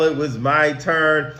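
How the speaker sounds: usual speaking voice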